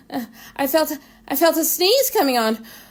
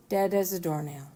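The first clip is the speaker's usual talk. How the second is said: ordinary delivery, nothing marked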